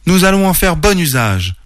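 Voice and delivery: high, eager cry; exclaiming. A narrator's reading. Oreille answers the oak, exclaiming eagerly. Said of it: "s'écria-t-elle".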